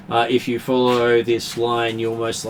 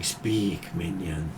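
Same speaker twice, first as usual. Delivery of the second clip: low commanding spirit voice; lower, looming otherworldly command; deep voice; 5 semitones lower, 7 dB quieter, much slower than usual. Acted. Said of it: "deep voice going".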